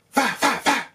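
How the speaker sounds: loud, high bursts of mouth noise; imitated gunfire. Viewer acting out Trent dodging, loud shot noises. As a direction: fire off three sharp imitated shots.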